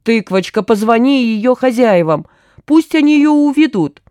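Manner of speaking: urgent pleading. A narrator's reading. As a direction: plead with growing agitation. Gurkin begs to be rescued. (всё настойчивее умоляет)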